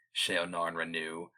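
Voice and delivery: ordinary delivery, nothing marked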